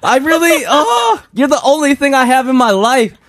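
hammed-up angry shout. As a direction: scream at the manager over a closed restaurant.

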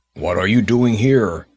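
loud, gruff giant shout. Acted. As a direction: bark it like an angry giant. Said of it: "he cried in a very gruff voice".

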